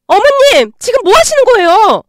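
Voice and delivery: high, loud outraged cry; shocked shout. Acted. Daughter-in-law yells at mother-in-law, shocked shout. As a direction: scream it in disbelief.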